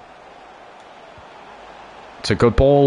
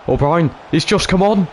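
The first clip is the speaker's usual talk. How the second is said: excited; 9 semitones higher than usual. Real, not acted. Chance created during live match.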